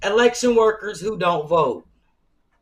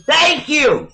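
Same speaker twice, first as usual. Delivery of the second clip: mimicked thank you; 9 semitones higher, 7 dB louder than usual. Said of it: "And that heifer said".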